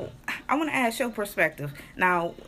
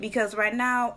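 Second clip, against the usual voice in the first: ordinary talk, nothing marked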